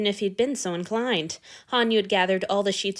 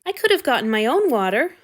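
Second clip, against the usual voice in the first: arguing; 4 semitones higher, 5 dB louder than usual. A narrator's reading. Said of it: "Hanyu argued".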